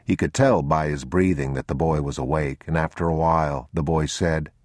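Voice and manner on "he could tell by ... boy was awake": ordinary delivery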